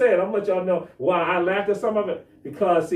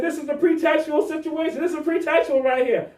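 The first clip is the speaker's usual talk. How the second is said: higher, pressing hard on pretextual; heated insistence; 8 semitones higher than usual. Retold or imitated. Man insisting to an officer during a traffic stop, heated. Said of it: "And I'm talking just like this".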